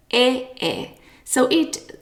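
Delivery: usual speaking voice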